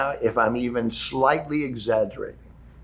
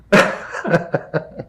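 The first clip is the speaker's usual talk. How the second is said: breathy laugh. Real, not acted. Laughs after the story.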